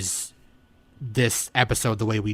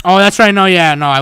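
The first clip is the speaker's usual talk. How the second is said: loud realization; 8 semitones higher, 12 dB louder than usual. Real, not acted. Caught out, laughing correction.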